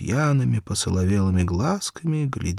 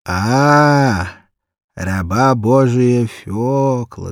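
put-on tender baby talk; 4 semitones higher, 6 dB louder, much slower than usual. A narrator's reading. Drunk shoemaker greets the little girl, slow tender baby talk. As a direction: coo at her like at a small child. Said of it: "нежно сюсюкая".

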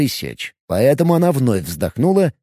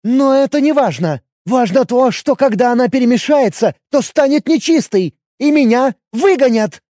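higher, louder agitated elf; agitated, pushing her point; upset loud elf; 11 semitones higher, 4 dB louder than usual. Acted.

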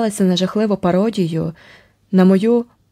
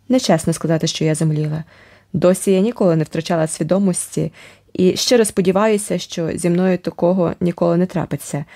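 ordinary delivery

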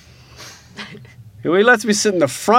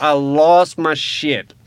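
ordinary talk

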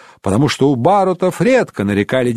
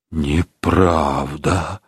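slow, much lower, softer, forced through a tight throat; choked out; 11 semitones lower, 4 dB quieter, much slower than usual. Acted. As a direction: squeeze Неправда out through a closed throat. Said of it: "с трудом выдавил Рейнован сквозь стиснутое горло". (сдавленно, медленно, низко и тихо: «Неправда»)

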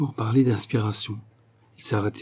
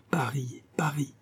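soft, unvoiced whisper; whispered lure; 4 semitones higher, 7 dB quieter than usual. Insinuating voices tempt Angèle with the city, whispered.

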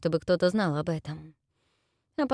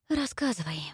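softer, exhaled word; breathed out; 6 semitones higher, 4 dB quieter than usual. A narrator's reading. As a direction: say it on an outbreath. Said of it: "выдохнула". (на выдохе)

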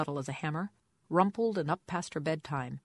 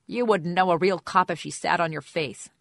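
angry; 2 semitones higher, 9 dB louder than usual. Acted. Lieutenant shouts back insult.

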